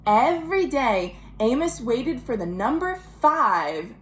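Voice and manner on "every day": ordinary delivery, nothing marked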